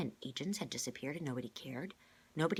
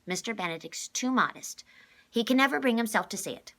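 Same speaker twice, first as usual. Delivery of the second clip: louder, higher forthright woman; blunt and emphatic; 5 semitones higher, 9 dB louder than usual. A narrator's reading. Number Two character voice.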